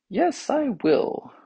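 very high, slow character voice; self-important tongue. A narrator's reading. Tongue agrees to help the others, self-important.

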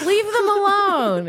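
high, drawn-out retold call; mock pleading yell. Friends telling him to leave girls alone, mock yell.

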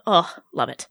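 ordinary talk, nothing marked

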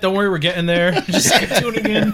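loud, high joke through laughter; laughing. Real, not acted. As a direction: call it out over the laughs, quick and high. Joking loudly over the others' laughter.

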